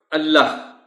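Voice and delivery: اللہ with the final h clearly heard; اللہ ending in a clear breathy h; final h sounded. Shown as a demonstration. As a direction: make the h at the end of اللہ audible. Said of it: "جو اے ہے آخری، وہ سنائی دینی چاہیے".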